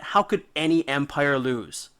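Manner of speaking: normal talking voice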